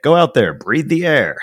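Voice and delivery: mock news hosts. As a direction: urge everyone on like a pundit, mockingly. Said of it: "Fox News hosts were like".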